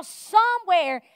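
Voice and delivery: ordinary talk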